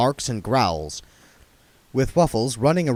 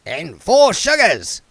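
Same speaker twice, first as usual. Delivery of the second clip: put-on raspy old beggar; 8 semitones higher, 7 dB louder, slower than usual. A narrator's reading. Arnold sideways voice.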